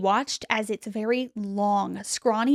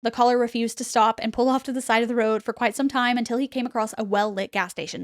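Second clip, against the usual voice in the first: plain reading voice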